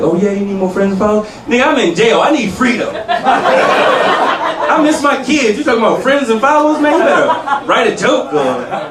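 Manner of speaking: jailed cousin. Retold or imitated. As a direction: scoff at the whining like the cousin. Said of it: "my cousin was like".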